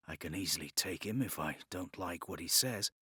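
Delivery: low, soft inner thought; reasoning quietly to himself. Acted. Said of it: "Duncan reasoned".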